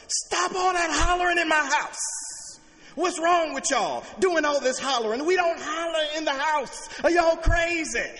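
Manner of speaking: high, loud yell down the stairs; hollering at the kids. Retold or imitated. Father yelling downstairs at noisy kids, full shout. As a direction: bellow at the kids to stop. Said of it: "I hollered to the top of my lungs".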